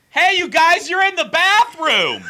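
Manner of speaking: very high, loud mock holler; retold high-pitched shout; imitated yelling. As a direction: holler it way up high like an excited friend. Imitates tipsy friend yelling out.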